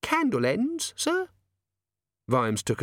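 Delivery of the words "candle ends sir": put-on high young maid voice. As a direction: voice the nervous maid, higher.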